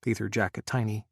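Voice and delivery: plain reading voice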